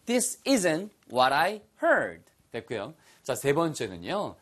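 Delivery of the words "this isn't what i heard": very slow, heard said /əd/; this isn't what I heard, drawn out; slow model sentence. Shown as a demonstration. Teacher modelling the sentence slowly with the right heard. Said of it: "발음이".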